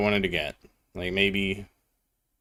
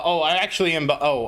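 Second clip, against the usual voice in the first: ordinary talk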